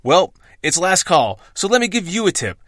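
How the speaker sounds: normal talking voice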